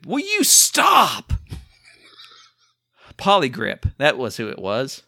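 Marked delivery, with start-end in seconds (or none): 0.1-1.3 exasperated plea. Real, not acted. Exasperated at cohost's interruptions.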